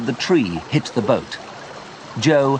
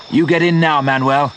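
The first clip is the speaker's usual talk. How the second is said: raised, firm insistence; insistent; 5 semitones higher, 4 dB louder than usual. A narrator's reading. Leon telling Manuel to board, raised and firm. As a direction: insist he goes first.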